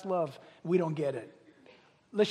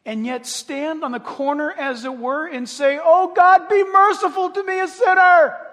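loud, high preached cry of the sinner's prayer; preaching at full force; 9 semitones higher, 12 dB louder than usual. Acted. Preacher voicing a sinner begging God for mercy, shouted. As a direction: cry it out from the pulpit.

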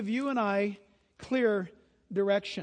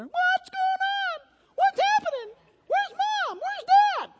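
much higher, slow chick voice; baby bird voices; 4 dB louder, slower than usual. Pretend. Pastor voicing baby birds looking for parents, high squeaky.

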